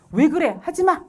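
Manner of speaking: acted stern scolding. Parent to an angry child, scolding. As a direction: shut the child's feelings down. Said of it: "감정을 억압하기가 쉬운".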